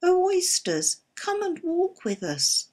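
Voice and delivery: the Walrus pleading sweetly, voice lifted high; beseeching. A narrator's reading. Walrus coaxing the oysters to walk with him, pleading and high. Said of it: "the walrus did beseech".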